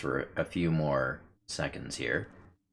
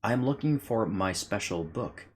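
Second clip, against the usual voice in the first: slow, odd little bug voice; firefly voice; 5 semitones higher than usual. Acted. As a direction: give the firefly a slow, strange voice. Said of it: "says the firefly".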